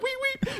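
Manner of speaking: tiny high squeak, softly done; imitated whistle. Repeating patient's whistle for friends, soft high squeal. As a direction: squeak it softly through laughter. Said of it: "Made the sound".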